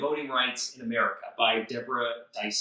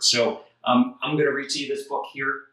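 usual speaking voice

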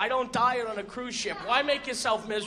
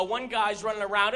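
ordinary talk, nothing marked

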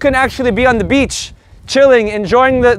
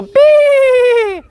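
long high "beee"; very high, held dolphin "bee"; dolphin squeal; 12 semitones higher, 3 dB louder than usual. Shown as a demonstration. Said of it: "the I is the dolphin".

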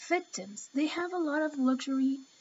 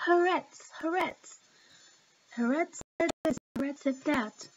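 higher-pitched, scornful parroting; mocking repetition; 3 semitones higher than usual. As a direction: mimic the endless quoting with exasperation. Mocks a paper everyone quotes.

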